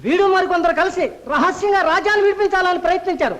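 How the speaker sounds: loud, high, urgent report to the lord. Acted. Guard reports the caught culprit to his lord, loudly. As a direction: blurt the capture out loudly.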